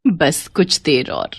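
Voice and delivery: sinister laugh. Acted. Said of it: "मुस्कुराते हुए".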